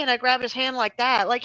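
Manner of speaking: normal talking voice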